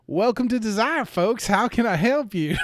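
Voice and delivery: high, loud, cheery welcome; overly friendly sheriff. Retold or imitated. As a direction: greet strangers like a beaming shopkeeper. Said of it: "he cleared his throat and goes".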